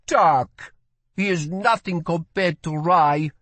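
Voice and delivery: put-on a curious young woman asks; girl's voice. A narrator's reading. Girl dismisses.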